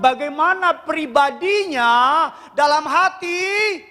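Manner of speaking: high, driving preacher's voice; preaching at full force. Acted. Pastor pressing the congregation, full-force preaching.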